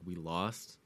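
low, soft, beaten; quiet and hollow; Captain America's line retold, quietly; defeated. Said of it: "Captain America be like".

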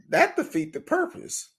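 high, disbelieving outburst; incredulous. Retold or imitated. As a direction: say it in sheer disbelief, voice jumping up. Mocking stranger's pointless act.

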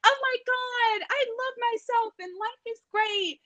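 very high, slow, drawn-out gush; mock gushing. People bragging about self-love journeys, high mock gushing. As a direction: imitate someone blissfully overjoyed with themselves.

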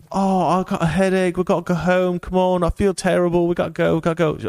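high-pitched mock English complaint; whiny English accent. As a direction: moan in a put-on English accent. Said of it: "Also English, right?".

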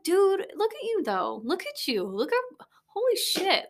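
high amazed praise; mock awestruck; self hype. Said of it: "I'm like".

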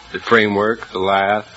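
normal talking voice

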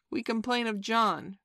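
put-on high, loud childish complaint; crying out. A narrator's reading. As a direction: call out like tattling twins. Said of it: "cried the twins".

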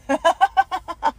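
a loud, high, self-mocking laugh; burst of laughter. Real, not acted. Vlogger laughing at herself for flubbing a fancy word, loud laugh.

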